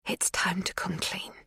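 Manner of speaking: soft confessing whisper. A narrator's reading. Magda whispering her confession to the girl. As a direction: let it out under the breath.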